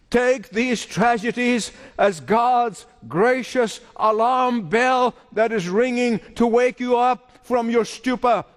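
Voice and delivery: ordinary talk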